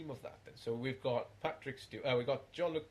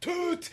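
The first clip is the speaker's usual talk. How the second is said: whistle imitation; 8 dB louder than usual. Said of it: "You gotta do the whistle".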